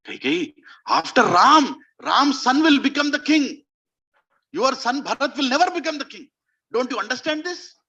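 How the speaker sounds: high-pitched, insistent warning; urgent persuasion. Acted. Mantra warns Kaikeyi about her son's future, urgent. Acts Mantra pressing the queen.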